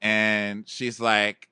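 usual speaking voice

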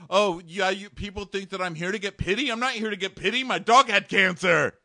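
high, worked-up rant impression; hammed-up defensive outburst; mock indignation. Voicing Josh indignantly denying pity.

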